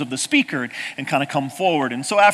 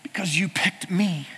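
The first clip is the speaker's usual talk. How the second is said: softer, slow, hushed whisper; 7 dB quieter than usual. Real, not acted. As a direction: breathe it like a secret you treasure. Emotional climax of testimony.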